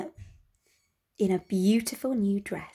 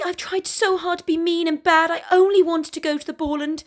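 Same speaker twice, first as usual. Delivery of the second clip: put-on higher, louder girl pleading; raised young girl's voice; Gertie's voice; 8 semitones higher, 5 dB louder than usual. Gertie explains herself to the wolf, higher.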